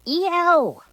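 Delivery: high, loud peacock screech; peacock shriek. Acted. Peacock screeching as the girl plucks plumes, loud.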